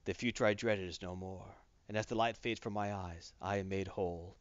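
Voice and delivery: low, soft, solemn dying voice; Thanos' dying thought. Retold or imitated. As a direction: let the last words fade out low and grave. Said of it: "he thinks".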